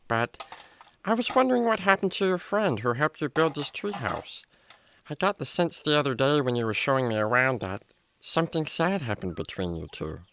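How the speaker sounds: normal talking voice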